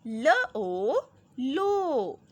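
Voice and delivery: put-on slow, drawn-out letter by letter reading for children; teacher spelling syllables slowly and loudly; slow spelling drill. Shown as a demonstration. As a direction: sound out each letter slowly, then blend. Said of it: "ग और ओ मिलके क्या बने?".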